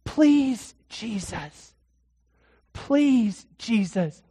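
pleading, each plea falling away; begging. Acted. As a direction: beg as if everything depends on it. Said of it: "He's begging".

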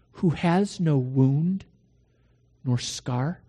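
slow, solemn poem with long pauses; measured devotional verse; poem recitation. Acted. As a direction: recite it slowly, weighing each question.

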